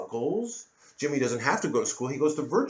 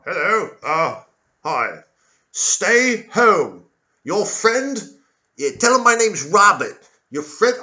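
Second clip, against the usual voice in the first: loud, high officious phone voice; government tracer calling the neighbor, loud and high officious; official caller; 7 semitones higher, 9 dB louder, slower than usual. Acted. Tracer phoning about Robert, high loud officious voice.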